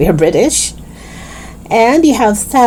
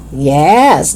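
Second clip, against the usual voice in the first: usual speaking voice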